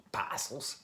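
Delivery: "Parcels" said PAH-sulls, fake Australian; bad Australian accent. Shown as a demonstration. Vlogger showing viewers his unedited bad Australian accent. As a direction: have another go at Australian, no R in "Parcels". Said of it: "that bad Australian accent".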